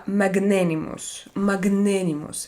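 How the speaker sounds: ordinary talk, nothing marked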